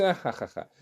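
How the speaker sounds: light mock laugh, spoken out syllable by syllable; imitated laugh. Krishna answering the Brahmin's curse, imitated laugh. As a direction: imitate a dismissive "ha, ha, ha". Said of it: "Krishna falou assim".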